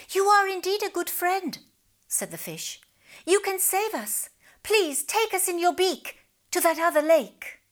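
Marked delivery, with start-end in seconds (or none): 0.1-1.6 high, eager little fish voice; grateful fish. Acted. 3.3-7.6 high, begging fish voice; pleading fish. Acted. Fish begs.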